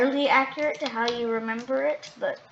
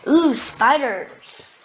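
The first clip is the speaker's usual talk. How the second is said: excited; 5 semitones higher, 7 dB louder, much slower than usual. Real, not acted. Finds spiders in the menu.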